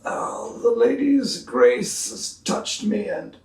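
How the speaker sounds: slow, reverent, high; rapturous councilman. Acted. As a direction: speak as if blessed.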